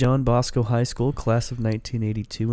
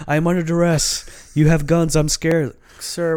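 high, slow, whiny mock fear; mocking the guy telling cops he is scared; mock scared man; 6 semitones higher, 4 dB louder, slower than usual. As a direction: mimic a man pretending to be frightened. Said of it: "he's like".

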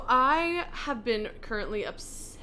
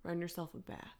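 Delilah voice; 3 semitones lower, 11 dB quieter than usual. Pretend. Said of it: "I'm gonna go Delilah on you".

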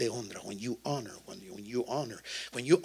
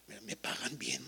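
whispered aside; 3 semitones lower, 11 dB quieter than usual. Retold or imitated. Retold inner thought.

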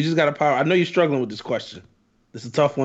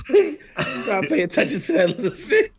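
laughing; 11 semitones higher than usual. Real, not acted. Laughing through a repeated line.

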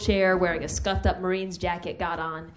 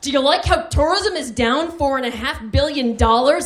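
imagined yelling; 5 semitones higher, 7 dB louder than usual. Retold or imitated. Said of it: "I yelled at these two idiots".

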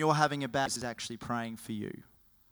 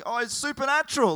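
high, loud gasp of wonder; awed surprise; 12 semitones higher, 8 dB louder than usual. Acted. Islanders amazed that Paul lived, high awed exclaim. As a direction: marvel out loud like a stunned islander.

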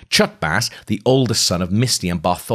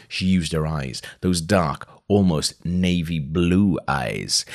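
ordinary delivery, nothing marked

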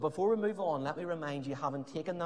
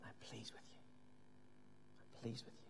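tender whisper; 5 semitones lower, 21 dB quieter than usual. Acted. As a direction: whisper "I'm pleased" softly, as God's gentle voice. God's voice spoken tenderly.

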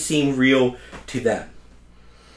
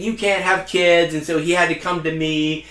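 usual speaking voice

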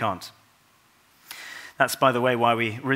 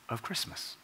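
hushed; 10 dB quieter than usual. Real, not acted. Hushed close on the wonder.

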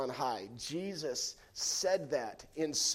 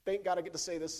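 ordinary delivery

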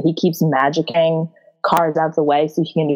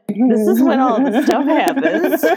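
talking through a rising laugh; 7 semitones higher than usual. Real, not acted. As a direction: crack up as the words come out, high and drawn out. Breaking into laughter while recapping.

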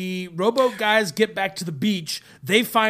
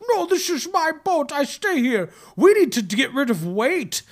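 put-on higher-pitched comic Dutch accent; 6 semitones higher than usual. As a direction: protest as a mock Dutch boatman. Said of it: "This is how all Dutch people talk".

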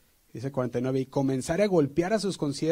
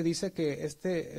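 usual speaking voice

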